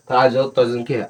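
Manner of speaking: ordinary talk, nothing marked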